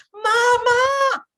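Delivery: high, loud panicked cry of Mamá; shrieking ¡Mamá! at the top of the voice; alarmed shout. Pretend. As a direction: call out in alarm like a frightened child. Children panicking at upset mother.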